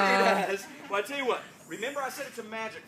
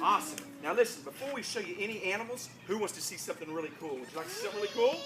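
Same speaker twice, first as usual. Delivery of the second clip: ordinary delivery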